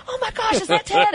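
high-pitched mock panic. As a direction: squeal it like a frantic caller. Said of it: "they're like".

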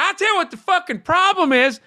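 high, worked-up Southern rant; mock political tirade in a Southern twang; passionate Southern accent. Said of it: "he was getting passionate, and the Southern accent".

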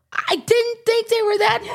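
very high squeaky mock-dismayed voice; high-pitched, hammed-up protest. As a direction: squeal it like a comic excuse to the bosses. Acting out explaining loss to bosses.

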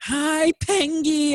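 high, sing-song flirty greeting; flirty Barbara. Acted. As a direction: coo it like a smitten villain. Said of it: "voicing Barbara greeting Penguin".

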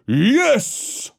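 high baying cry; bay it like a wolf pack; baying wolves. Acted. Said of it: "the wolves bayed".

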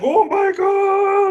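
high, slow, drawn-out mock-panicked gasp; high stretched oh my God. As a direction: play a frazzled server tech seeing the overload. Overloaded Pornhub staff panicking.

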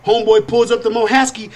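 normal talking voice

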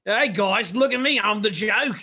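mock an overexcited Aussie performer showing off; Rebel Wilson. Podcaster mocking the Omega character's voice, over-the-top Australian accent. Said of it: "it's like Rebel Wilson downed".

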